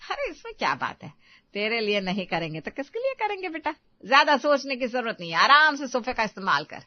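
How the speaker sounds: a lightly voiced indulgent mother; doting mother. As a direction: reassure your daughter with easy affection. (बेटी को दिलासा देती लाड़ली माँ की आवाज़)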